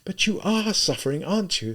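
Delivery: high-pitched, distressed appeal; plaintive, high woman's voice; woman's cry. A narrator's reading. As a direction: cry it out high, pleading with him. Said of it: "Sonia cried".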